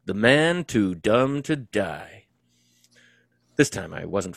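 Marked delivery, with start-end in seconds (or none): 0.0-2.2 slow, louder teasing jab; slow ribbing delivery. Acted. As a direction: draw out the jab slowly. Harry teases wounded friend.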